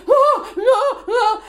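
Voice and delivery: high, loud distressed wailing cries; panicked wail. Retold or imitated. Late mum wailing at the news, high and loud. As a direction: let out a mock despairing wail. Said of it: "And I'm like".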